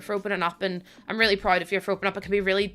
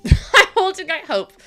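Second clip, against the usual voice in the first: higher and louder with a laugh; 8 semitones higher, 5 dB louder than usual. Real, not acted. Streamer to her chat about early access, laughing. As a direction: laugh it out the second time.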